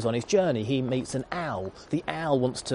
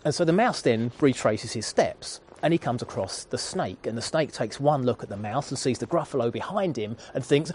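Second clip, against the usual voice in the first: normal talking voice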